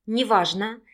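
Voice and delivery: plain reading voice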